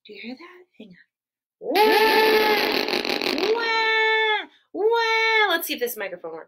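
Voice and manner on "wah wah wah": high, loud fake baby wail; hammed-up crying for the kids; loud mock wailing. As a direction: wail like a toddler having a meltdown. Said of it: "It sounded like this".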